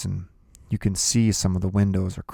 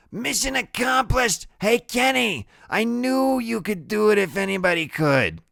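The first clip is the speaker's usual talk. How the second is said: put-on much higher, bright drunken welcome; tipsy woman cheering. Loretta welcoming Kenny home, high drunken cheer. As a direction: cheer him home like a party girl. Woman greets returning man.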